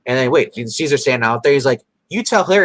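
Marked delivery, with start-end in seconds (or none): 2.1-2.6 mock retort. Sheriff retorts.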